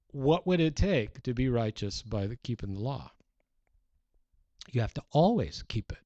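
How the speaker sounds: ordinary talk, nothing marked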